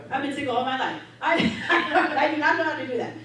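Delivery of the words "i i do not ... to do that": laughing. Real, not acted. Laughing admission of not knowing.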